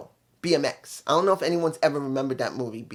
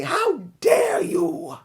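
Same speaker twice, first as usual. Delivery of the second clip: shrill, cracking mock shout, much higher and slow; outraged impression; 3 dB louder, much slower than usual. Toni Storm standing over Luther, high cracking outraged shout. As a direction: scream it like an offended diva.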